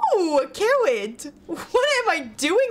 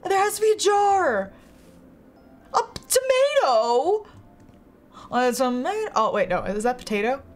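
usual speaking voice

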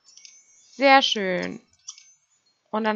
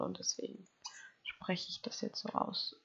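tonlos geflüstert; flüster die Erklärung; Flüstern; 10 dB quieter than usual. Real, not acted. (leise, tonlos erklärt)

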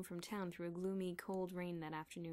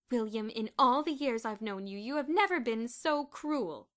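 loud, slow, outraged rebuke; indignant; 4 semitones higher, 12 dB louder than usual. A narrator's reading. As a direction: confront your husband. Wife confronting her husband.

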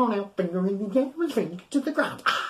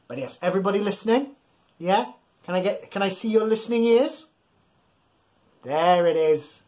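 normal talking voice

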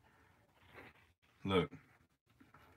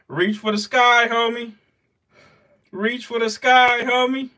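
taunting; 11 semitones higher, 6 dB louder, slower than usual. Real, not acted. Taunting the dying prey.

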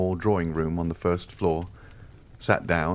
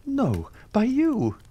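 much higher, slow, pointed; lightly voiced young man flattering; emphatic flattery; slower than usual. A narrator's reading. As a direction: lay it on thick. Andrea insisting on the Count's help.

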